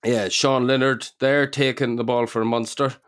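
put-on a lightly done Irish commentator accent; Irish accent drifting between Northern and Southern. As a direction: call the rugby play in a loose Irish accent. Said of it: "What was that accent?".

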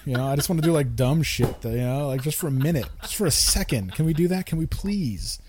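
loud, rising plea; exasperated pleading. Acted. Man begging partner for dumb fun, loud exasperated pleading.

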